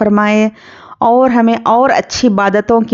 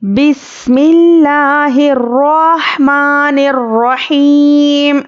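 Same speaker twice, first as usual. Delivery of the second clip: slow, melodic, higher chanted recitation; Quran recitation; 3 semitones higher, much slower than usual. Acted. Teacher recites the surah for her students, melodic chant. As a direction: recite with long held melodic vowels.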